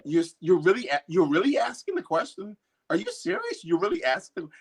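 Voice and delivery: mock-amazed, as if the question were absurd; incredulous friends. Friends reacting to being asked if she's a perfectionist, amazed. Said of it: "and they go".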